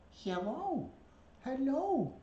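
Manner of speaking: high, singsong hello to a pet bird; pet-talk voice. Real, not acted. Getting a pet to say hello, high cooing pet-talk. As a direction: coax the bird in a high little voice.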